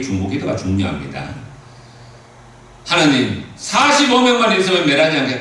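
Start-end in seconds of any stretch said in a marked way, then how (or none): 2.8-5.4 high, loud, earnest plea; pleading Abraham. Acted. Preacher voicing Abraham pleading loudly to God. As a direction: beg God for the city.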